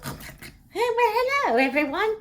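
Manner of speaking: very high, comic just-woken voice; woozy waking greeting. Acted. Patient waking in recovery greeting everyone, comically high voice.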